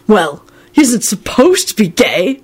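slow, voiced mock-dramatic aside; dramatic stage whisper. A narrator's reading. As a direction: say it slowly like juicy gossip. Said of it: "George whispered dramatically".

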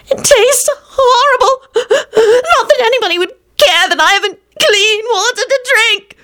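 high-pitched self-pitying wail; shrieking. A narrator's reading. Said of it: "she shrieked".

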